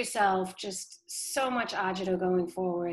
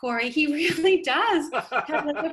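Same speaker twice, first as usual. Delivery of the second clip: laughing; 7 semitones higher, 7 dB louder than usual. Real, not acted. Amused at her own story.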